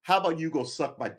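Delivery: ordinary delivery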